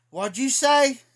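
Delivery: shocked demand. Retold or imitated. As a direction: demand it back in disbelief. Retold shock at the insult.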